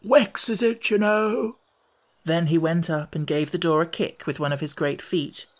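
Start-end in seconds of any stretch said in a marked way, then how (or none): 0.0-1.6 high frog voice with mispronunciation; a dull old frog complaining. A narrator's reading. Old frog warning Alice about the door.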